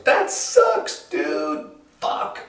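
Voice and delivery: very high falsetto villagers; dismayed village. Acted. Village commiserates over the runaway horse, squeaky and dismayed. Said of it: "The whole village goes".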